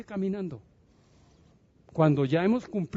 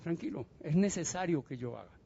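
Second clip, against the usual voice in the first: soft and reassuring, much quieter; calming Pedro; 6 dB quieter than usual. Acted. Jesus calming Pedro about his death, soft and tender. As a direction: gently settle him down.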